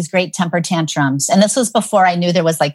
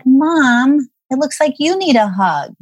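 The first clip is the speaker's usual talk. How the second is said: lightly voiced small child, pitched higher; little daughter's voice; 6 semitones higher, slower than usual. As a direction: gently imitate a child consoling Mom. Three-year-old comforting crying mother.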